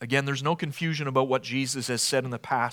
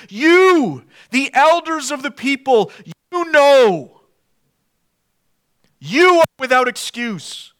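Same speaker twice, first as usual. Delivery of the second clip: much higher and louder, pressing every you; emphatic preaching; 9 semitones higher, 7 dB louder than usual. Retold or imitated. Preacher voicing Jesus confronting the Sanhedrin, loud and pressing. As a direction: drive it home at full force. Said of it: "he emphasizes the "you".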